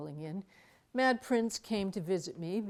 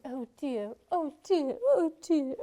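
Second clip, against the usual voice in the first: flustered dithering; 8 semitones higher, 9 dB louder than usual. Retold or imitated. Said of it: "slipping down this beam going".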